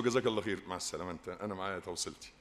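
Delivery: a quiet, humble no thanks; hushed, modest decline to a disciple; polite refusal. Retold or imitated. Sheikh politely declines the ride.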